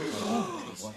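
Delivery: embarrassed gasp. Acted. Director stops before saying "mother.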